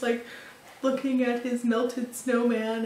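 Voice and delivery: usual speaking voice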